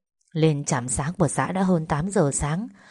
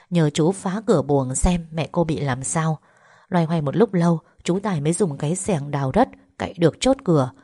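usual speaking voice

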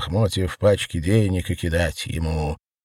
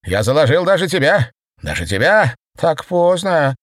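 offended grunt; 8 semitones higher, 4 dB louder than usual. A narrator's reading. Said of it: "обиженно хрюкнула она".

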